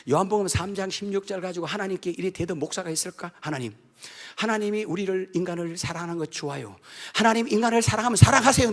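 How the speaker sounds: ordinary talk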